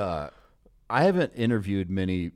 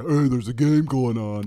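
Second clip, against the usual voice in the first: mock annoyed fan; 4 semitones higher, 6 dB louder than usual. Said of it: "get some social media post".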